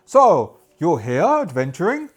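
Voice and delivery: loud, emphatic trader's voice; merchant character. Acted. Merchant asking newcomers if they're adventuring, loud trader voice. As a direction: boom the question out as a merchant. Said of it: "he'll go".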